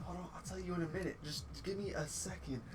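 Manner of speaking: soft, quick brush-off; hushed aside. Retold or imitated. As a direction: imitate a distracted murmur from a phone call. Retold aside while on phone.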